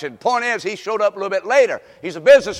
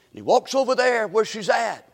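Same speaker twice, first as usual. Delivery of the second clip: ordinary talk, nothing marked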